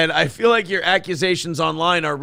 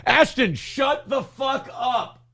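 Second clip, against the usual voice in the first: exasperated lawyer; 8 semitones higher, 5 dB quieter, slower than usual. Retold or imitated. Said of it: "lawyer voice".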